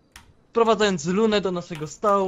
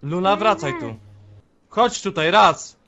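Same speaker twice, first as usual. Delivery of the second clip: raised, commanding call; stanowcze wołanie; 5 dB louder than usual. Acted. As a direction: order her back, building louder. Przywołuje odchodzącą Lunę. (głośny rozkaz powrotu)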